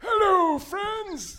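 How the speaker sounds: put-on high, cheery old man greeting; old wizard voice. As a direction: welcome them like a frail, delighted old host. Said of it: "I was not expecting that voice".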